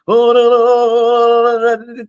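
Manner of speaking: high, drawn-out mock-holy "oh"; mock spiritual voice. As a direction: put on an over-spiritual high, held tone. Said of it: "All, all that spiritual".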